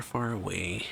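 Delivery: ordinary delivery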